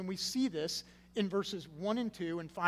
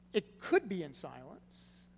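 slow allowance, leaning on "could"; stress on COULD; slower than usual. Shown as a demonstration. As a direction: draw it out as a concession on "could". Conceding after the denial.

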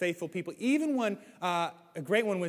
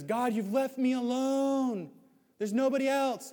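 slow, higher whiny complaint; self-pitying lament; 7 semitones higher, slower than usual. Retold or imitated. Elijah complains to God that he is alone, slow whiny lament. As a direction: moan it like a despairing prophet. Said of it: "Stop crying".

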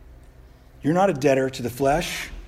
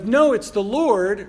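higher, louder, slow and insistent; eager Peter; 6 semitones higher, 6 dB louder, slower than usual. Retold or imitated. Peter correcting the disciples, higher louder eager insistence. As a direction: correct them, sure and thrilled. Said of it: "he's like".

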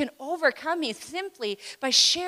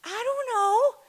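high bewildered man; 13 semitones higher, 6 dB louder, slower than usual. Retold or imitated. Healed man answers council.

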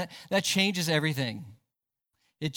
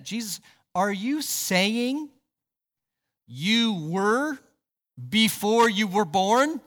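slow, higher-pitched mock disbelief; incredulous question; 4 semitones higher, slower than usual. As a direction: imitate a puzzled listener, spacing out the words with a questioning rise. Acting out astonished listeners' question.

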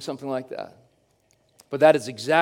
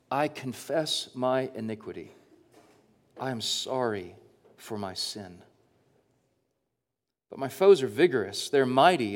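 scripture reading; 3 semitones lower than usual. Acted. Reads psalm aloud.

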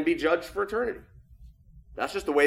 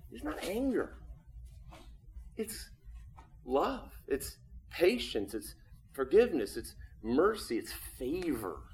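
tender hush; 8 dB quieter than usual. Real, not acted. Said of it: "soft sermon close".

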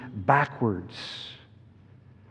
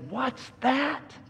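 high-pitched, softer startled question; startled little Lucy; 12 semitones higher, 5 dB quieter than usual. A narrator's reading. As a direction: voice a startled girl in a story. Frightened girl in the story.

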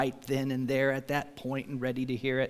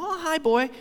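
coaxing dog call; 13 semitones higher, 5 dB louder than usual. Retold or imitated. Greeting the dog at gate.

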